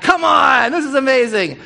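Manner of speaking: loud, high burst of real delight; excited shout. Real, not acted. Preacher finds the movie's river and shouts in delight. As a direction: shout it like you've found the very spot.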